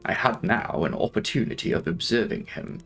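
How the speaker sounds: ordinary delivery, nothing marked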